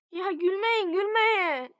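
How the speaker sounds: half-annoyed loud plea to siblings; telling off. Real, not acted. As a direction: snap at the gigglers to quit. Scolding brothers who are laughing.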